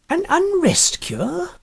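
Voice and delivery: high, slow incredulous echo; baffled echo. A narrator's reading. Huddle echoing his friend, high and incredulous. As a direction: repeat it as if it's nonsense.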